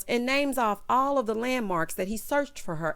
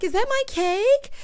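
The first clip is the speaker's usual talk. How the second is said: high, louder excited squeal of a question; mock excited bride; 12 semitones higher, 5 dB louder than usual. Bride spotting her cake, mock excitement. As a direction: imitate a bride thrilled by the sight.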